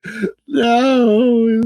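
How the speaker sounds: high, loud frustrated wail; high despairing mock-anguished no; exasperated outcry. Real, not acted. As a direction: cry out at them in disbelief. Frustrated outburst at regulators.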